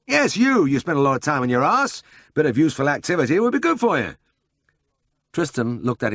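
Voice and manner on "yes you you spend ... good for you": high, lively voice for the bustling boss; brisk Siegfried. A narrator's reading. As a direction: play the eager older brother full of a plan.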